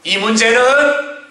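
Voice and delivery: high preacher's cry as a king; impassioned royal command. Acted. King sending courtiers away to face God alone, impassioned shouting.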